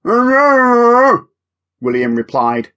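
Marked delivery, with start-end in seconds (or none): 0.0-1.3 muffled reply, higher and louder; mouth closed on a ball. Acted. William answers with his mouth on the ball, muffled. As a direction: answer through a mouth full of football. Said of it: "his mouth closed tightly on the battered old football".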